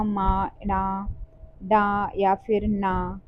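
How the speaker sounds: मा, ना drawn out slowly, each syllable held; slow nasal sound demo. Shown as a demonstration. Teacher slowly sounds out nasal letters for exam students. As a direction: sound out मा, ना slowly through the nose. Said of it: "जैसे".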